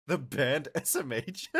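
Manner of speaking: loud, slow words breaking into laughter; laughing. Real, not acted. Streamer reacting to chat's confusion over a band, laughing. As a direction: crack up as you say it.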